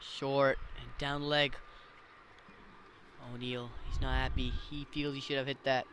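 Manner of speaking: usual speaking voice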